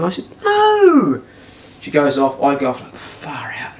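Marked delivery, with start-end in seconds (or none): 0.4-1.2 very high, drawn-out mock-innocent no; exaggerated denial. He denies to her harming the cat, exaggerated innocence. 3.0-3.7 breathed out, very soft; whispered relief. Retold or imitated. He exhales once she has gone, whispered relief.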